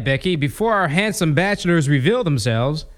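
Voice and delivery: ordinary talk, nothing marked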